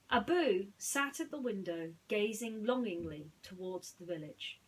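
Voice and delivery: put-on bright read-aloud story voice for children; lively storybook reading; storytelling narration. A narrator's reading. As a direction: tell it like a bedtime storybook. Reads Aladdin story to viewers.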